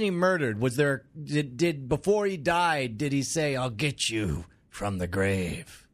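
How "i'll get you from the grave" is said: slow, low spooky voice; slow, low vengeful ghost voice; mock ghostly threat. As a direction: drag it out like a ghost's curse.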